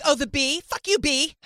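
tough dismissive voice. Retold or imitated. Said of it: "She'll be like".

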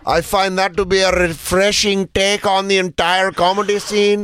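high mock Indian cabbie; Indian accent. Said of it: "with an accent like that".

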